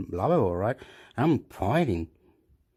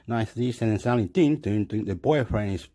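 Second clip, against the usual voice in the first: usual speaking voice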